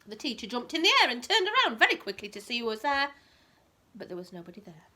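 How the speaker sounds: lively bedtime story reading, rising on the key words; reading to a child. A narrator's reading. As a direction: read it like a picture book to a toddler.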